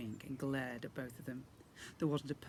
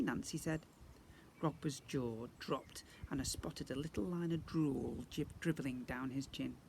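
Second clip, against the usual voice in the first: plain reading voice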